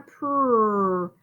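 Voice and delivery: "Purr" drawn out to show the P R blend; sounding out pr. Shown as a demonstration. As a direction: isolate the P R sound. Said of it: "Did you hear that P R?".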